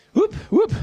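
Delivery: high excited whoops; cheering whoops. Real, not acted. Coach cheering during workout.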